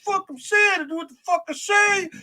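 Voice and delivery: high, pushed mock tough-guy voice; Max impression. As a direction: raise the pitch and bark it like a hothead. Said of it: "will be like".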